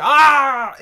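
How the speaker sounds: long, high loud "ah" yell. Real, not acted. Gamer choking near ninety points, loud yell. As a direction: burst out yelling mid-sentence. Startled cry during game.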